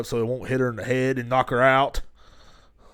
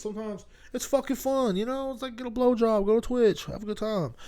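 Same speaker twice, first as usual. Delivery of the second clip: mock high voice; 10 semitones higher, 4 dB quieter than usual. Silly high put-on voice.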